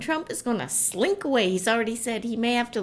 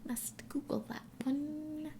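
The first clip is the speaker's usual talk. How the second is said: whisper; 5 semitones higher, 12 dB quieter, slower than usual. Real, not acted. Aside about asparagus.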